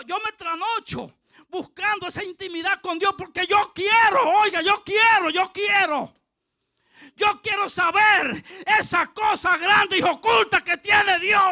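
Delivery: loud, high cry of longing, building to a shout; impassioned preaching. Real, not acted. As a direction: hammer out each repeated wish at full force. Preaches at full force.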